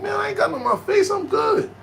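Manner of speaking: very high, quick insistence; acted defiant bravado. Fighter checking his face in the car mirror, high-pitched bravado.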